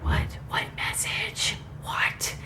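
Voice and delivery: hushed, breathless whisper; confused whisper. Retold or imitated. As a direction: whisper it in disbelief. Confused reaction to TV.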